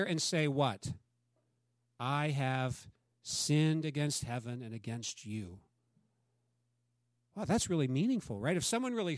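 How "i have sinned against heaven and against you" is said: humble confession. Acted. Prodigal son's confession acted out.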